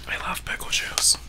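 soft ASMR whisper. Real, not acted. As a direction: whisper close to the mic. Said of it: "I can't really whisper like that".

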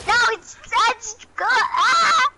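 high, frustrated outburst; getting mad. Real, not acted. Player fighting the game's building edit, frustrated. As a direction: break off into a high exasperated cry. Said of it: "I'm getting so mad right now".

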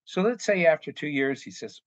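usual speaking voice

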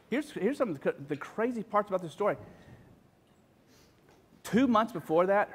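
ordinary talk, nothing marked

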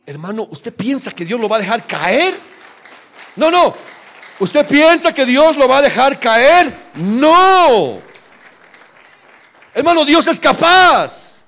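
loud, high, slow preaching; full-force preaching. Real, not acted. Pastor thundering at the congregation that God is able.

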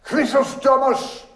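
very high-pitched call; exclaiming. A narrator's reading. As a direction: cry it out high, like a summons. Character's exclamation in the story.

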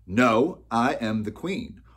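loud, high, defiant declaration. Acted. Opal standing up to the miller, defiant. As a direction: stand your ground at full voice.

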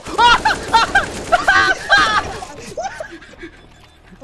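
high burst of laughter. Real, not acted. Big laugh at teammate.

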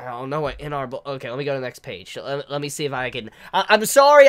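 normal talking voice

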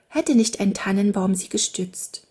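ordinary delivery

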